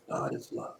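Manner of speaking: almost whispered, breathy and low in level; hushed. Shown as a demonstration. Preacher breathing the words God is love, whispered. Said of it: "You know, scripture says".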